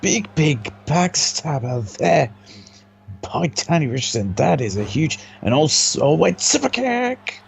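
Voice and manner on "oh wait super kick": sudden high excited call. Real, not acted. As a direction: cry out the move in surprise. Big move called live.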